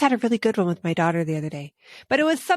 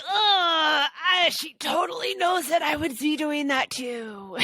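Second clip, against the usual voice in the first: a hammed-up groan turning into a higher half-shout; mock exasperated groan; 5 semitones higher, slower than usual. Mom hams up her frustration at being outwitted, mock groan. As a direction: moan it out in mock despair, pitched up.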